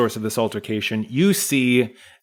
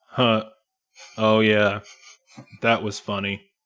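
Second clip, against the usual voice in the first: unmoved, just state the facts; monotone dwarf; 3 dB quieter than usual. Acted. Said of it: "Jorn is expressionless and monotone".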